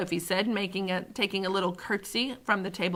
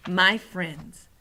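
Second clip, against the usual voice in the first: usual speaking voice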